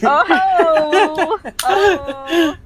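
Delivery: loud, high laughing "oh"; real laughing exclamation; laughing outcry. Real, not acted. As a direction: burst out laughing at the joke. Reacting to cohost's crude joke.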